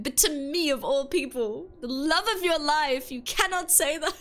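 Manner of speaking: high, theatrical protest; playful indignation. Real, not acted. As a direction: pile up the outrage phrase by phrase. Playful indignation at loved one.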